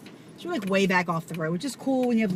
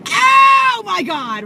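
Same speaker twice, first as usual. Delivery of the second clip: high, very loud, slow scream; run-over bug horror shriek; horrified shriek; 12 semitones higher, 13 dB louder, much slower than usual. Retold or imitated. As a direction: shriek at the crunch. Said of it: "and I'm like".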